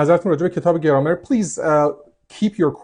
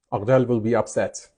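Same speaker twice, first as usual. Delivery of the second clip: normal talking voice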